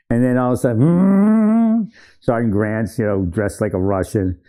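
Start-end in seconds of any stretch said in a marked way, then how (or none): 0.8-1.9 high, loud rising hum; imitated hum. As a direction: mimic a sudden noise with a rising hum. Imitated noise as story turns sudden.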